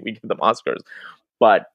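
ordinary talk, nothing marked

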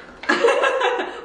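sudden high-pitched burst of laughter. Real, not acted. Laughing at choking joke.

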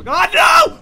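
rising panicked shriek; panicked scream. Real, not acted. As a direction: scream it as it catches you. Chased in horror game.

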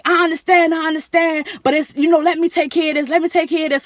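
a high, lightly voiced soothing staff tone; calming staff member. Staff member calming the angry mother, lightly voiced. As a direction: placate her firmly. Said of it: "So Miss Selena like".

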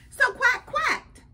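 high, drawn-out quacking; duck talk. Acted. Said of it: "he's talking in duck language".

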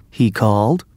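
ordinary delivery

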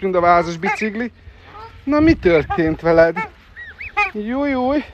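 high, loud squealing over the scuffle; excited squeals. Real, not acted. Watching the birds fight, high loud squealing. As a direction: squeal and fuss at the bird fight.

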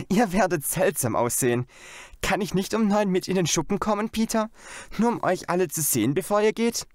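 put-on pleading girl. A narrator's reading. Janet begs Peter to come to the shed, pleading girl voice. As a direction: beg brother like an eager little girl.

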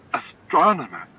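half-choked distaste; almost choking. A narrator's reading. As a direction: push the word out as if it sticks in the throat. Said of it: "He almost choked on the word".

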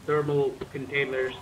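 normal talking voice

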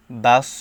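বাস with a heavy, emphatic final s and darker vowel; pronounced with sad. Shown as a demonstration. Teacher contrasts two letters, বাস said with a heavy sad. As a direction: end বাস on a thick, full sad. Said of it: "বা সদ জবর".

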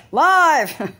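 high, loud showbiz yell of live; booming show opener cry; mock announcer shout. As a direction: belt it like a TV opener. SNL-style shout of live.

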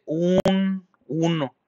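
"un uno" slow and high, with UN held long; stretched emphasis. Shown as a demonstration. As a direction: draw out "un" to point out the one. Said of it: "fíjense". ("un uno" alargado y agudo, con énfasis)

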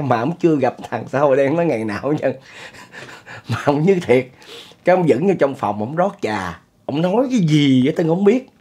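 ordinary talk

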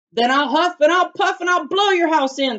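high, loud menacing threat; coyote threat. Acted. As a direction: roar the threat at the little house. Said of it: "said Coyote".

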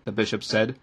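plain reading voice

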